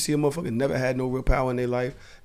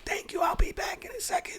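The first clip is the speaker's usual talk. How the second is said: whisper; 6 dB quieter than usual. Retold or imitated. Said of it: "You don't have to whisper".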